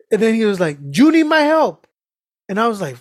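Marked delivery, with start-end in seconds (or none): 0.8-1.9 loud, high-pitched insistence; frustrated. Retold or imitated. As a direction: imitate exasperation, voice shooting up. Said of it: "he was getting frustrated with me".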